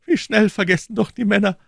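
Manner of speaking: high, loud lament in a woman's voice; exclaiming wife. A narrator's reading. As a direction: cry it out as a reproachful wife. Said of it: "rief die Prokuratorsfrau".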